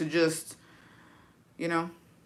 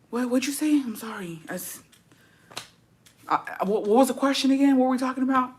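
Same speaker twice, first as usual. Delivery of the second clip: mock zoned-out patient; 5 semitones higher, 4 dB quieter than usual. Acting zoned out in therapy.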